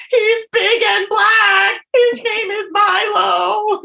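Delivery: high, drawn-out wailing; mock bawling. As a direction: blubber it out slowly, wildly overdone. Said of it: "uncontrollably bawling my eyes out".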